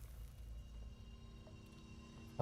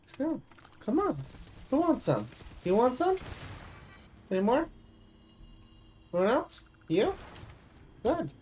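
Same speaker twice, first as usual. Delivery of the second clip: cocky taunt; 11 semitones higher than usual. Acted. Gamer taunting the crabs, high cocky calls. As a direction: taunt the crowd like a brawler.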